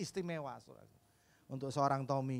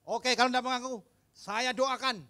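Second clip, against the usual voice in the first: higher, louder stern threat; threatening pastor; 9 semitones higher, 8 dB louder than usual. Retold or imitated. Pastor threatening the orphans who won't confess, higher and louder. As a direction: threaten them with a prayer. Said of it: "kata Pak Pendeta".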